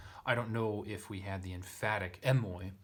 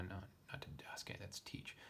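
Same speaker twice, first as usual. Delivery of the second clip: whispered aside; 11 dB quieter than usual. Real, not acted. Self-correction muttered aside.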